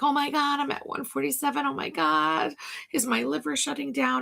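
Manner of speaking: lightly panicked self-quote; retold freak-out. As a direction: recount a worried spiral in a rush. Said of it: "I was really freaking out".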